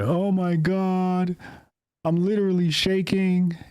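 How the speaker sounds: a hammed-up, trembling online liberal; mock panicked liberal.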